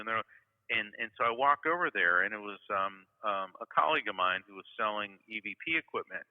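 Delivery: usual speaking voice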